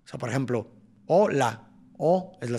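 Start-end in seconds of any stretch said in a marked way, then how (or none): 1.1-1.7 first syllable of "hola" punched, second dropping away; stressed syllable. Shown as a demonstration. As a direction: lean hard on the HO in "hola". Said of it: "tiene una sílaba tónica".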